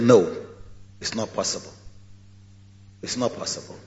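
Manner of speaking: low, soft, almost whispered no; quiet refusal. Acted. Father Abraham quietly refusing the rich man's plea. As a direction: deny him gently but firmly.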